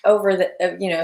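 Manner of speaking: ordinary talk, nothing marked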